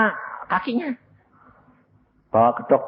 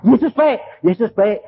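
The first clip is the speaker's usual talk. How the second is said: "Yesus baik" diteriakkan tinggi dan keras; dengan keras; 7 semitones higher, 5 dB louder than usual. Retold or imitated. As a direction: teriakkan "Yesus baik" seperti perintah. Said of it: "Ngomong apa?". ("Yesus baik" diteriakkan lantang)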